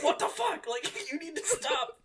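high-pitched, laughing mock outburst; mock outrage. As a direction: squeal the protest, breaking into laughter. Retold outburst at an explicit explanation.

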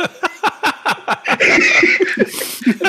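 squealing laughter, way up in pitch; a long delighted cackle; long high laugh. Real, not acted. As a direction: dissolve into giggles. Laughing at own punchline.

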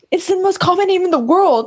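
high-pitched, amused imitation of indignation; mock exasperated quote. Quoting the fake ID argument, mock exasperated. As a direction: act out the movie comeback, giggly. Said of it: "He goes".

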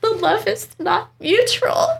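very high, laughing mock rebuff; mock rejection. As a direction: turn down a suitor, hammed-up. Retold rejection of the dog.

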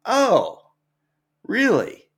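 high, falling oh and really; retold surprise, pitched well up; surprised. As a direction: react with acted pleased surprise. Flattered surprise at being recognized.

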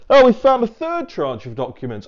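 high, loud mock surprise; mock announcement. Mocking repeated announcements of found documents.

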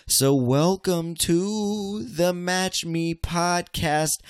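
high, slow, laid-back show opener; slow stoned host intro. Acted. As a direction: ease them in like a late-night host.

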